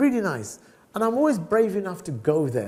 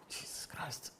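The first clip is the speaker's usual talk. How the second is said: exasperated whisper; 3 semitones higher, 9 dB quieter, slower than usual. Retold or imitated. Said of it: "And I'm like".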